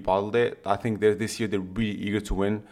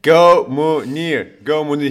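slow, drawn-out and much louder cheer; 4 semitones higher, 9 dB louder, much slower than usual. Real, not acted. Cheering on the Moroccan striker, long and loud. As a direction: stretch out a big rooting call.

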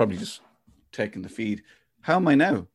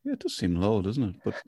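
ordinary talk

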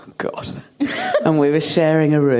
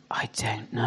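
tiny unvoiced whisper; small sheepish whisper; whispered child; 9 semitones lower, 12 dB quieter than usual. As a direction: breathe it like a sheepish toddler. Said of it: "he goes".

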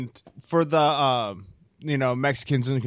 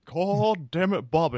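a hint of a drawling fed-up father; mock Texan grumble; Hank Hill impression; 6 semitones higher than usual. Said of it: "more like that".

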